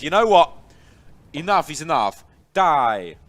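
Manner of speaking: hammed-up shout with an Argentinian accent. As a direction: belt it out in a put-on Argentinian accent. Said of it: "That was my Argentinian accent".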